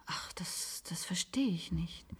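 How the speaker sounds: soft, slow breathy whisper. Acted. Maggie confides her puzzlement to the patient in a whisper. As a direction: hush it as if someone could hear.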